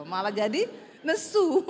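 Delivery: normal talking voice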